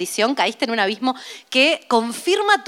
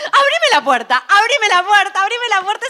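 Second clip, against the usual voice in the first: louder, higher, laughing "abrime la puerta"; mock pleading yell; 6 semitones higher, 6 dB louder than usual. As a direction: beg at the locked door, half joking. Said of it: "Y yo digo". (súplica a gritos en broma)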